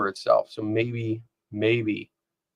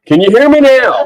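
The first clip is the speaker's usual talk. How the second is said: loud mock phone test; 11 semitones higher, 11 dB louder than usual. Repeating the classic phone test line.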